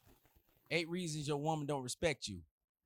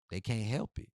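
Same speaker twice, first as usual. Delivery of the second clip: normal talking voice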